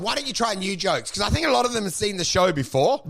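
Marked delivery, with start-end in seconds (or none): none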